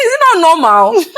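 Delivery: high, loud retold disbelief; incredulous question. New mother questioning her friend, acted incredulity. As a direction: pitch it up in acted astonishment.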